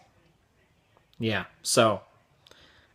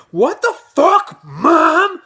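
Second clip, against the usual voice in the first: loud, high, drawn-out mimicked yell; pissed-off kid; 14 semitones higher, 8 dB louder, much slower than usual. Twelve-year-old to his mom in store, pissed-off yell. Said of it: "he was pissed that his mom did that".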